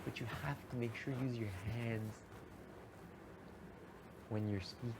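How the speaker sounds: soft, lightly voiced calm father; an understated imitation of dad giving advice; Doctor Reese. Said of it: "let me see if I can do Doctor Reese".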